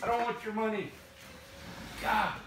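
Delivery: loud, high-pitched refusal; worked-up, put-upon complaining; exasperated protest. Pretend. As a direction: protest it loudly, fed up at being woken. Still refusing money, being woken.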